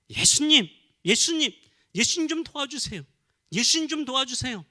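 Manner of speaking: high, loud pleading cry; preacher showing the congregation how to cry out to Jesus. Shown as a demonstration. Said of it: "라고 부르짖는 거예요".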